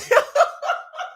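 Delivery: high, airy giggle; real giggle, high and unvoiced; breathy giggle. Real, not acted. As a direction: giggle at your own joke. Laughing at her own bit.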